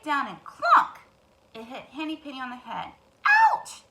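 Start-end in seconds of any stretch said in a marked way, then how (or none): 3.2-3.8 high loud squawked ouch; cried ouch. Acted. Hen yelps when the acorn hits her head. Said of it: "cried Henny Penny".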